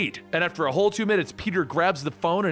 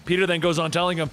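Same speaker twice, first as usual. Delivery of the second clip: usual speaking voice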